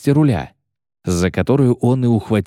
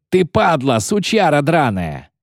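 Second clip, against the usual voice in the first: furious yelling; 6 semitones higher than usual. A narrator's reading. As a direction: curse him across the water. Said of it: "заорал Коббин". (яростная брань криком)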